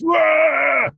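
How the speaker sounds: sudden high shouted "wah"; startled yell. Acted. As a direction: burst out with a high, loud cry. Sudden yell opening a scene.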